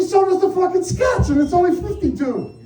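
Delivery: husband scoring a point; indignant retort. Retold or imitated. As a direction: fire it back as a comeback.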